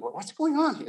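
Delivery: jumps way up in pitch, puzzled; a buddy barging in, baffled; startled question. Retold or imitated. Buddy walking in on two men crying, asks in high surprise.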